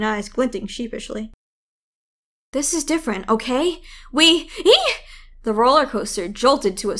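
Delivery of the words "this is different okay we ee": nervous then squeal. Acted. Nervous Sam as coaster starts.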